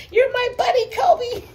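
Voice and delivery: high squealing puppy talk. Real, not acted. Cooing at puppy, laughing.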